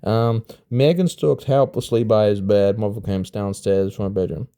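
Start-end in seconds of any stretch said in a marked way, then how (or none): none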